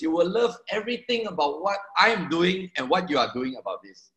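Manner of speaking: ordinary talk, nothing marked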